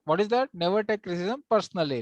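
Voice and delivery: ordinary delivery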